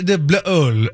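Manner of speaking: mock French accent, h dropped from "blowhole"; bad French accent. Host imitating the man in Maui, bad French accent. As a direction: say "blowhole" like a Frenchman, as "bluh-ole". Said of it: "this bad French accent".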